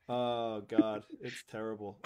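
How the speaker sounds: ordinary talk, nothing marked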